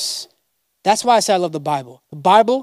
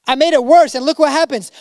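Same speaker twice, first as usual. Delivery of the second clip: higher, pleading complaint to God; a frustrated Moses griping upward, voice raised; exasperated Moses; 7 semitones higher, 3 dB louder, faster than usual. Retold or imitated. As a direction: whine it out like a man at the end of his rope. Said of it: "I can imagine Moses being like".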